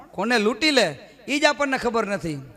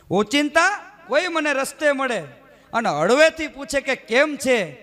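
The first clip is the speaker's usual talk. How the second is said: poem recital; 5 semitones higher than usual. Shown as a demonstration. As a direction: savour each word of the poem. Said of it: "ચાર લીટી ગમે છે".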